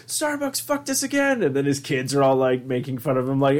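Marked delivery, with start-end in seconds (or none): 0.1-1.4 put-on high, aggrieved Irish accent; mock-bitter Irish accent. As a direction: grumble in an Irish accent like a wronged local. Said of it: "doing all these different Irish accents".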